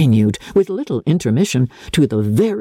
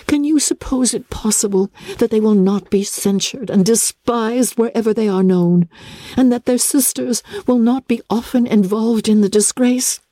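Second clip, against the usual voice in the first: put-on higher, earnest young woman's voice pleading with her father; a daughter begging her father to see the danger, voiced higher and earnestly; Elizabeth pleading; 10 semitones higher than usual. A narrator's reading. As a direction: lift the voice and urge him anxiously.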